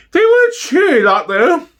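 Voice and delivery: high falsetto gossiping housewife; East End housewife. Acted. Neighbour reminisces to the interviewer in a high falsetto housewife voice.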